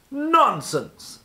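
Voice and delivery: high, loud snort of a word; snorted nonsense. A narrator's reading. Wizard dismissing Mister Sneeze, loud snorting scoff. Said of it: "snorted the wizard".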